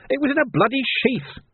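very high indignant protest, little added volume; screaming. A narrator's reading. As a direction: protest in a high, outraged pitch. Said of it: "screamed the twisting prisoner".

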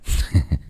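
short breathy scoffing cackle; a rude dry snigger; harsh snicker. Acted. Wastrel son sneering at his father, harsh snicker. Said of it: "nó cười khạch".

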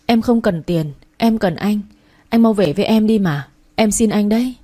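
plain reading voice

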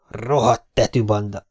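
ordinary delivery, nothing marked

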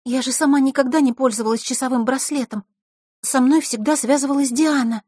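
high, anxious explaining; frightened. A narrator's reading. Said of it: "со страхом прошептала она". (испуганно, высоким голосом)

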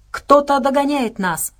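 slow, high-pitched cry of warning; shouted warning. A narrator's reading. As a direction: call out in alarm, drawn out. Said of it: "крикнул он".

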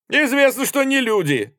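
high, loud callout; crowd heckle. A narrator's reading. Voices from all over the carriage answer, heckling loudly. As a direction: shout it back across the carriage. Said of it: "раздались голоса в разных концах вагона".

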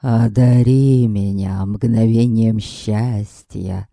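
slow, drawn-out lovesick declaiming; vampire serenade. Acted. Vampire serenading Yaslava under her window, slow and pompous. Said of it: "Ишь, надрывается!".

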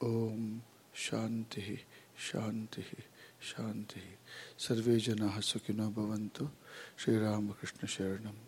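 soft, even chant with pauses between phrases; hushed, measured prayer recitation; Sanskrit prayer. Real, not acted. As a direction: recite the invocation quietly and evenly. Opening Sanskrit prayer before the lecture.

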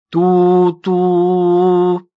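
long loud toot; train horn imitation. A narrator's reading. The narrator imitating the oncoming train horn in the tunnel. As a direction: sound like a distant train whistle.

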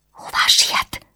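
unvoiced breathy whisper from a sickbed. A narrator's reading. Sick Anna whispers to Eszterházi, a weak whisper. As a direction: breathe the question without voice. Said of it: "suttogta Anna".

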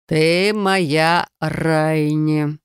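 slow, tired drawl; weary. Acted. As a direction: sigh it like a weary ruler. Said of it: "произнёс он устало".

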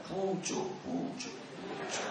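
high, breathy nibbling caterpillar noises. Pretend. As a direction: play a tiny caterpillar munching the shoot, almost voiceless. Ulat memakan pucuk. (suara ulat kecil menggerogoti pucuk, hampir berbisik)

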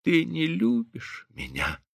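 slow, soft, drained voice; weary. A narrator's reading. Wounded wife repeats it to her husband, wearily. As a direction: say it faintly, worn out. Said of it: "устало повторила она".

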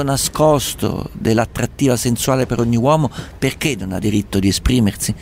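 smooth late-night radio host. Acted. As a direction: present it like a radio show host. Radio host introduces a letter. (conduttore radiofonico notturno)

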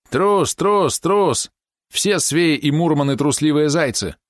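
high, chanted mock of a coward; narrated childish jeer, pitched well up; jeering taunt. A narrator's reading. Boy jeering at the rival who won't fight, jeering taunt.

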